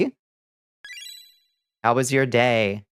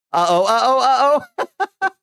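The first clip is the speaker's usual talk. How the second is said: laughing uh-oh; 13 semitones higher, 4 dB louder than usual. Real, not acted. Bot reacts scared.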